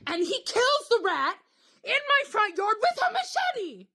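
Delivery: high, slow, incredulous squeal; horrified disbelief. Real, not acted. Telling how her date killed the rat, shrilly aghast. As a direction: stretch each word out in scandalised shock.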